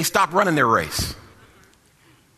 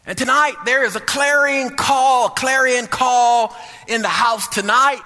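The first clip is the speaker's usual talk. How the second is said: high, ringing sermon climax with drawn-out words; full-force preaching; 5 semitones higher, slower than usual. Real, not acted. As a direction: preach it slowly, pitched up, calling out the congregation.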